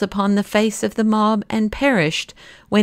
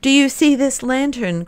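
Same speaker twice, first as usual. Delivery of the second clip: a fierce cry, pitched high; terrible voice; 6 semitones higher than usual. A narrator's reading. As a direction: make him freeze and listen. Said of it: "cried Syme in a terrible voice".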